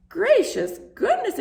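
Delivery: wife hears her name on the radio, astonished; exclaiming. A narrator's reading. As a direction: burst out in amazement. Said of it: "exclaimed Missus Popper".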